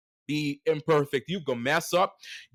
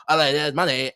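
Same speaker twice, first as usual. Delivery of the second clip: normal talking voice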